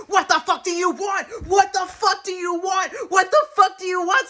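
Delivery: high, loud, furious repeated demand; enraged shouting, building with each repeat; screaming Santa. Acted. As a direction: scream it right in their face. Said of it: "screaming at me".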